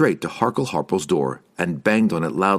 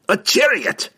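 high, forceful dwarf growl; 4 dB louder than usual. A narrator's reading. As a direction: growl it impatiently. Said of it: "Bruner growled".

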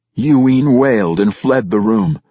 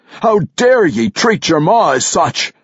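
higher, louder dwarf king roaring; a furious king shouting in his face; 13 semitones higher, 4 dB louder than usual. A narrator's reading. Dwarf king shouting at Brunner for dishonoring his father.

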